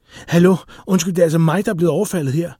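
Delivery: loud, high-pitched outrage; furious shout. A narrator's reading. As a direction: shout in indignant protest. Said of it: "råbte hun rasende".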